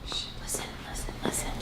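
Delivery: unvoiced hushing whisper. Real, not acted. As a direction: shush them and whisper listen. Hushing to hear the sound.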